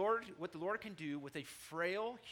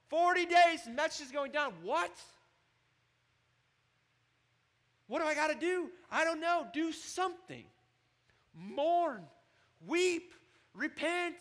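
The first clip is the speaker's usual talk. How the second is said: much higher and louder, frantic and pleading; panicked Ninevite; 12 semitones higher, 10 dB louder than usual. Acted. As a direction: cry out desperate to do something.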